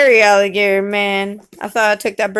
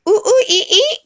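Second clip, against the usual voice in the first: high, loud monkey hoots; monkey sounds; 12 semitones higher, 3 dB louder than usual. Pretend. Parent showing child what the monkey says, high hooting. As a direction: squeal it like a little monkey.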